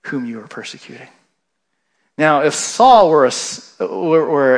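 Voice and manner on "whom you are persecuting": hushed divine reply. Retold or imitated. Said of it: "He says".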